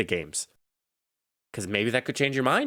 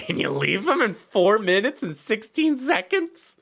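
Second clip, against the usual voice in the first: high-pitched, disbelieving question; incredulous outrage; 10 semitones higher than usual. Real, not acted. Outraged at coach's short goodbye.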